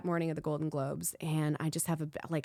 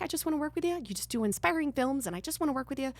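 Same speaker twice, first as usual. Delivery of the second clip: high, ingratiating repeated plea; retold wide-eyed flattery, pitched up; mock innocent pleading; 7 semitones higher, faster than usual. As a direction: keep up a harmless, eager act in a high voice. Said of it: "I just kept repeating that".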